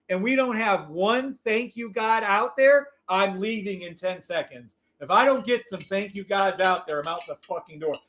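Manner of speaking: ordinary talk, nothing marked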